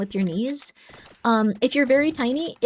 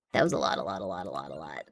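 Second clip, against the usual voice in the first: deep goofy voice; 8 dB quieter, faster than usual. Pretend.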